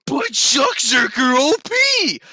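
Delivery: loud, slow whiny mock shout. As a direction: yell it like a dumb complaint. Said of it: "everyone goes".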